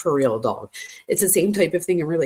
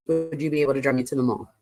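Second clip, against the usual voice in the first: low, rapid read-through; quick recap; 4 semitones lower, faster than usual. Retold or imitated. Quickly rereads the emphasized text.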